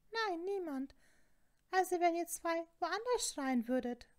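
high-pitched scolding matron voice; indignant Fat Lady. A narrator's reading. Portrait telling off two noisy students, high and indignant. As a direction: put on a high, affronted voice. Portrait shoos the pair away.

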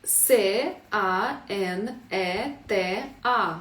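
«А Н Е Т А» дуже повільно, по літері; роби паузу після кожної літери; повільна диктовка. Shown as a demonstration. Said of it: "Наступне слово". (вчителька диктує слово по літерах)

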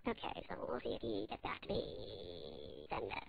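muttered aside. Acted. Caller mutters to herself at the computer, hushed and low. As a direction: mumble to yourself over the keyboard.

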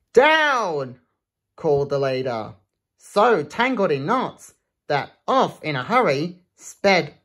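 lively rhythmic read-aloud; reading a rhyming book to children; storybook reading. Acted. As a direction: bounce through the rhyme for kids. Reads picture book aloud.

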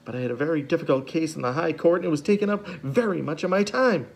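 a hint of a flustered neighbour; lightly voiced Justice Strauss. A narrator's reading. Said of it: "Justice Strauss speaking".

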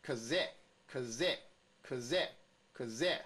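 kaze slowly, a short k then a longer stressed zeh; sound drill. Shown as a demonstration. Teacher shows students how the letters sound, sound drill. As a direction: say kaze in separated takes, weight on the second sound. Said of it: "看这个字的话是".

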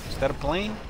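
ordinary delivery

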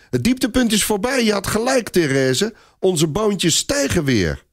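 put-on high, elated child voice; jubilant, high-pitched calling; excited boy calling out. A narrator's reading. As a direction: announce good news in a little boy's high voice. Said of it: "roept hij zijn vriendinnetje toe".

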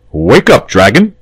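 sudden commanding call; sharp. A narrator's reading. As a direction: rouse him sharply. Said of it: "he said sharply".

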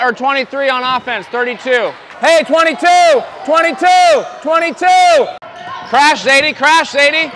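loud, high coaching yells across the court; sideline shouting. Real, not acted. Coach yelling instructions to players on the court, loud. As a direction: call the plays out over the crowd.

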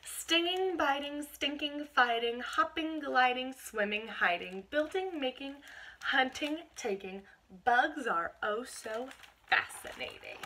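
lively listing read-aloud; a hint of storybook sing-song; reading to children. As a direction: rattle off the bug words like a storybook chant. Reads picture book to children.

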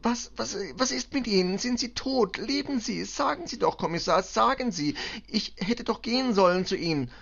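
very high, panicked pleading; frantic, agitated. A narrator's reading. Mother begs commissioner for news of her children, frantic. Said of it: "beugte sich aufgeregt weit über den Schreibtisch".

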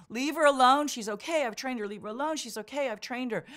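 loud high yelling to defend her; retold scream. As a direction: shout him off her. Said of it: "Arnie started screaming".

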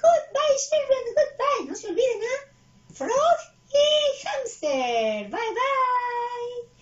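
high, loud puppet farewell; piercingly high cartoon voice, last words stretched; Jelly Bean. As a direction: squeal goodbye to the children as a little character. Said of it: "Muy bien, despídete".

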